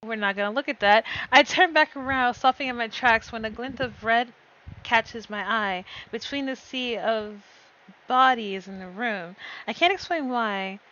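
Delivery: steady first-person storytelling; story narration. Acted. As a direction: read the scene like a novel. Narrates her story chapter.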